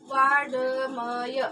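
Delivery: वाड्मय drawn out very slowly, vaa-da-maya; slow wrong वाड्मय demo; unjoined reading. Shown as a demonstration. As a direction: stretch वाड्मय syllable by syllable. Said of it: "क्या हो रहा है इसका उच्चारण?".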